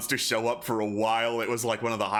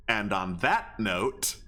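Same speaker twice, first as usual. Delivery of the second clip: usual speaking voice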